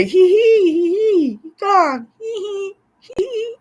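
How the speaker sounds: very high hee-hee witch voice; squeaky, high-pitched mock witch glee; witch cackle. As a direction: cackle like a witch on her broom. Plays a cackling witch with broom.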